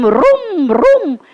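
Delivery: revving rickshaw vroom, rising and falling; auto engine noise. Retold or imitated. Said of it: "How does it go?".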